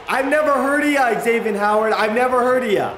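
high, loud mocking call; loud taunt. Real, not acted. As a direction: jeer that you never heard of him. Taunting opposing player.

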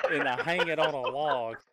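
loud laugh breaking through the words. Real, not acted. Telling the podcast hosts his blunder, laughing. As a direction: laugh it out at your own mistake.

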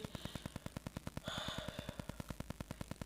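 slow, soft breath out, voiceless; deep settling exhale; long calming sigh. Shown as a demonstration. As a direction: let a long sigh go before speaking. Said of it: "just pause and say".

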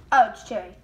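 normal talking voice

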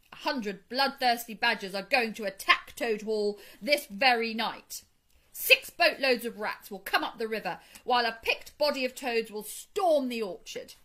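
loud, emphatic warning; forceful, boastful scare story; bragging threat. A narrator's reading. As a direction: frighten the sentries with a tall tale. Mole retells his bragging threat.

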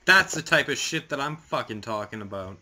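high, loud startled cursing; real frightened outburst; frustrated yell. Real, not acted. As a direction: yell out at the scare. Jump scare in horror game.

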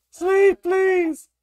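high, loud desperate plea; loud, very high begging; frantic pleading. Real, not acted. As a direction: beg at a panicked squeal. Pleading to sleep at nightfall.

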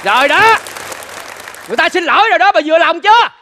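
fed-up husband having his say; exasperated reproach. Acted.

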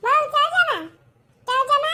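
high, loud call for granny; loud child's call. Acted. Child shouting for granny, high and loud. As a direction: yell for granny.

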